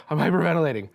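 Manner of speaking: normal talking voice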